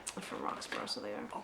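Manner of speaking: very quiet, low aside; muttered aside. Retold or imitated. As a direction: drop to a near-whisper, imitating a knowing verdict. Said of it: "I could hear someone".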